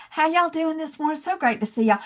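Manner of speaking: a hint of Southern accent, friendly and light. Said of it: "with my Southern accent".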